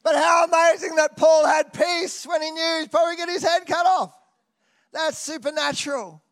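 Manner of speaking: high, loud preaching cry; full-force preaching. Real, not acted. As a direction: belt it out in amazement. Preacher building to climax.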